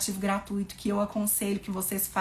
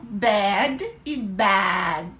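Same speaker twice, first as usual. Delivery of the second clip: ordinary delivery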